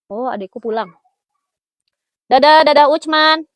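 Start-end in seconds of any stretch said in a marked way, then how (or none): 2.3-3.5 loud, higher, drawn-out farewell call; calling out goodbye. Real, not acted. Host calls bye-bye to Ucman, loud and drawn out.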